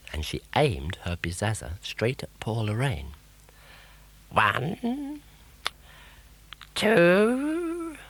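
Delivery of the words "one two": high, drawn-out menacing count; witch's countdown. A narrator's reading. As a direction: count it out slowly like a pantomime witch.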